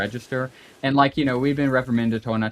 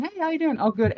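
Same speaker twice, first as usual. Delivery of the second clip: light, high-pitched mock cheery hello; mock cheery greeting. Retelling coworker's cheery greeting, lightly mock cheery. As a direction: play the chirpy coworker, bright and high.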